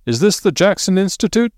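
high-pitched cheerful caller; cheery. A narrator's reading. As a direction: ask it high and cheerily through a door. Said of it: "said a cheery voice outside".

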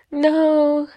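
high, drawn-out no; sheepish admission. Retold or imitated. Sheepish answer to a reproach.

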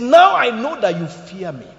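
slow and forceful; emphatic quote. Acted. Preacher quoting God to Abraham, slow and forceful. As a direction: lean into each word of the quote.

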